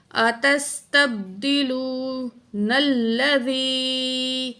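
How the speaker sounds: slow, drawn-out tajweed chant; Quran recitation. Shown as a demonstration. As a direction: recite slowly with long vowels. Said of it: "نون کو لام تشدید کے ساتھ ملائیں گے". (آہستہ تجوید کے ساتھ تلاوت)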